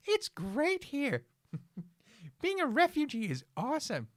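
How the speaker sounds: high squeaky mocking voice; sing-song sarcastic cartoon voice. Mocking refugees in silly voice.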